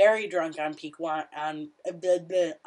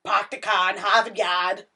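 put-on Boston accent, a little louder; 5 dB louder than usual. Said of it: "I low-key don't hate Boston accents".

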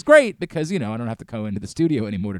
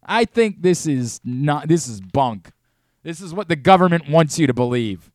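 louder, higher outraged truther rant; conspiracy rant; 5 semitones higher, 6 dB louder than usual. Acted.

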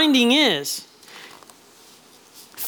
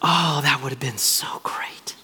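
breathless whisper, much softer; 7 semitones lower, 5 dB quieter than usual. Real, not acted. Preacher wishing he were in the boat, hushed whisper. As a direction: lean in and whisper your wish.

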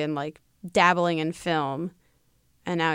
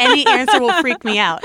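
loud exclamation stressing any; mock freak-out; 4 semitones higher, 9 dB louder than usual. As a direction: play up the panic on any. Comic freak-out, then repeated calmly.

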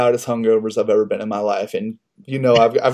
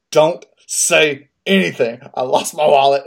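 barking at wife; 3 semitones higher, 5 dB louder than usual. Retold or imitated. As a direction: snap it out at your wife over the phone. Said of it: "barked at her".

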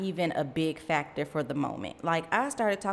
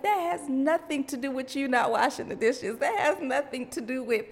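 surprised; 6 semitones higher than usual. Retold or imitated. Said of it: "I was like".

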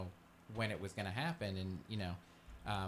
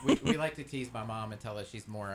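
normal talking voice

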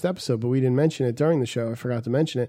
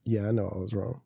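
sheepish admission; 7 semitones lower, 10 dB quieter than usual. Retold or imitated. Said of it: "I'm like".

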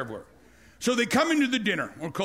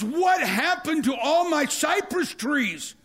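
high, slow dismayed cry; the king finding his garden ruined; outraged king; 7 semitones higher, slower than usual. Retold or imitated. King finds garden destroyed.

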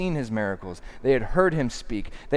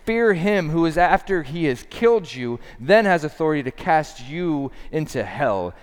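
raised, insistent preaching; pointed; 4 semitones higher, 4 dB louder than usual. Acted. Jesus warning who can cast into hell, pointed and urgent. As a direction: press each phrase home pointedly. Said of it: "I love how pointed he is".

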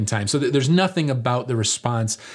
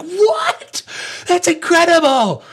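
shrieked much higher, louder, laughing; hammed-up astonished disciples; amazed disciples; 7 dB louder, slower than usual. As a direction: gasp it out in disbelief.

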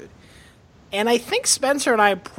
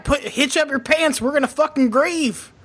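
higher-pitched, hard-charging Southern pep talk; shit-kicking grief counselor; 6 semitones higher than usual. Pretend. Grief counselor rallying mourners, shit-kicking Southern voice. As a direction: play grief therapy as a mock tough order. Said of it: "doesn't work if you're a grief counselor".

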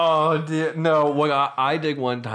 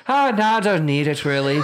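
put-on Irish accent; 3 semitones higher than usual. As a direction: decline in a hammed-up Irish lilt. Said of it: "my Irish accent isn't".

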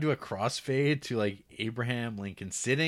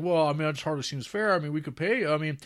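mock folksy settler; 6 semitones higher than usual. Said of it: "And they're like".

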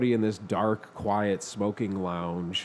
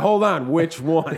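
loud, high and urgent; 5 semitones higher, 7 dB louder, much slower than usual. Retold or imitated. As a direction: hold on, which one, pressing hard. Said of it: "I was like".

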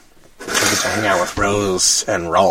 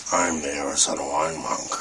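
Batman voice; 7 semitones lower, 4 dB quieter than usual. Pretend. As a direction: lower superhero growl. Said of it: "I'm Batman".

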